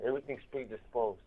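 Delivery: usual speaking voice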